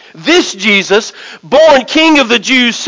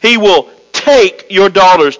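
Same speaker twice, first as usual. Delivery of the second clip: "take" set apart and punched; stress on TAKE; 2 semitones lower than usual. Shown as a demonstration. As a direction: lean hard on "take", pausing around it. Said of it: "I'm gonna emphasize the word".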